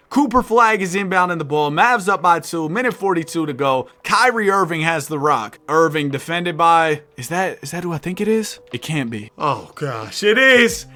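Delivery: live sports commentary, rising with the action; energetic announcer call; play-by-play. Acted. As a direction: call the game like a broadcaster. Live play-by-play of game sim.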